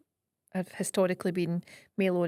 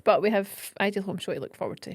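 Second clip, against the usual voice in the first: ordinary talk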